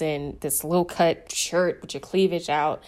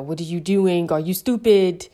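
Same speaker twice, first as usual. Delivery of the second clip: tough boss. Retold or imitated. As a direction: snap at the new hire like a strict mentor. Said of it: "The tough".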